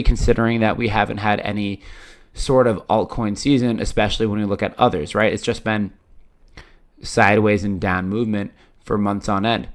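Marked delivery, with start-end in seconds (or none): none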